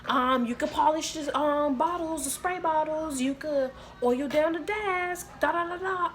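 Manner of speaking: mock the manager listing chores; a hammed-up bossy manager giving orders; mimicking the bossy manager. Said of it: "She like".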